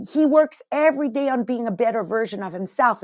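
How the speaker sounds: normal talking voice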